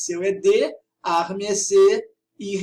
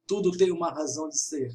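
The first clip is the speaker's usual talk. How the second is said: ordinary talk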